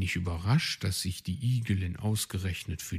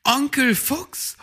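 high, loud call across the clearing; young hare calling eagerly; 7 dB louder than usual. Acted. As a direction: call to the fox, voice rising high. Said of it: "rief Weißohr".

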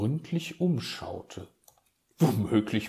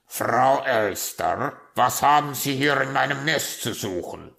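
rasping raven; 4 semitones higher, 9 dB louder than usual. A narrator's reading. Raven catching an intruder in his nest, rasping. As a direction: rasp it out like an affronted raven. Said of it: "schnarrte es plötzlich hinter ihr".

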